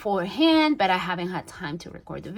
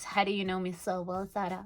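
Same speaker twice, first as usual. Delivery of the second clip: ordinary delivery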